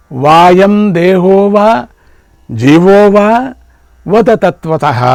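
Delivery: slow, emphatic verse intoning with ringing long vowels; measured, forceful scriptural recitation; Sanskrit verse recitation. Acted. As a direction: draw out each phrase of the verse, pausing between.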